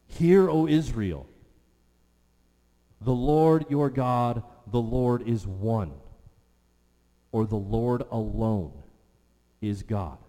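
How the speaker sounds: booming recital of the Shema; proclaiming scripture. Acted. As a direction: call it out like a herald.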